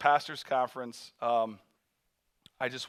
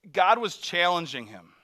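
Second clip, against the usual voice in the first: ordinary delivery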